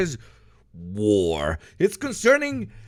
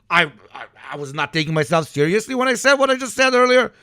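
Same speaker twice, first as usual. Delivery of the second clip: usual speaking voice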